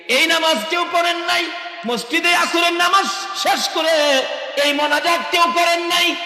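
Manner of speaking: loud, very high impassioned sermon shout; full-force preaching. Real, not acted. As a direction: hurl the challenge out to the congregation. (উঁচু গলায় চিৎকার করে ওয়াজ)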